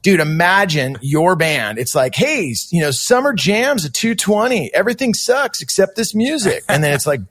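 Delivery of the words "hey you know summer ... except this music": mock playlist announcer.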